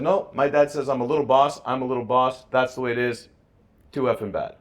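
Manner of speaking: put-on high, loud, cocky child insisting; defiant kid. Son arguing with his teachers, loud defiant child voice.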